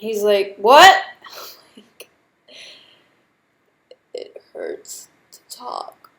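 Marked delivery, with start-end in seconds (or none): 0.6-1.0 sudden loud, higher "What?"; medic asking what. Retold or imitated. Medic can't hear her. 4.1-6.1 slow, soft whisper, much lower; repeating herself to the medic in a pained whisper; strained whisper. Retold or imitated.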